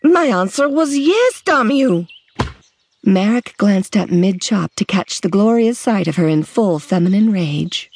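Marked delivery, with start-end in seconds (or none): 0.0-2.1 high furious outburst; angry shout. A narrator's reading. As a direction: fling it at him in rage. Lily furious at Marek.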